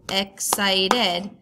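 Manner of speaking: excited split into three separate beats, ex-cit-ed; clapped-out syllables. Shown as a demonstration. Teacher clapping out syllables for students, excited in separate beats. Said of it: "If you clap out the syllables".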